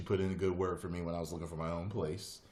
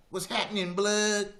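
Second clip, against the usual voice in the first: high, loud jive greeting; hammed-up retro slang caricature; old-time jive talker; 7 dB louder than usual. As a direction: imitate an outdated jive greeting, pitched high.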